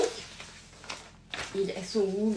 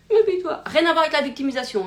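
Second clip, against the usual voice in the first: higher, louder whiny mock lament; mock self-pity; 6 semitones higher, 5 dB louder, faster than usual. Shown as a demonstration. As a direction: wail it like a self-pitying victim. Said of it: "la théâtralisation des émotions". (plainte apitoyée jouée, plus aiguë et plus forte)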